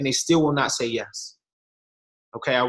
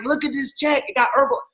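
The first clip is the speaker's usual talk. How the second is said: high, eager show-off voice; excited bragging; 9 semitones higher than usual. Retold or imitated. To his cousin, proudly showing off the first check, excited. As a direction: thrust the check under his nose.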